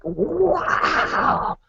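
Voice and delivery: high shouted wow, then slow marvel; stunned by the empty place; awed wow. Retold or imitated. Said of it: "I'm like".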